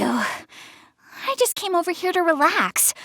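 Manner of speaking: soft slow whisper to herself, unvoiced. Girl unwinding after a flustered rant, whispering slowly to herself. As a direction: breathe it out as if only to yourself.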